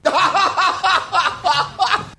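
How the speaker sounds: rapid, high-pitched cackle; laughter. Real, not acted. Host laughing at the noise they made, high cackle. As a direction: burst out laughing in quick high bursts.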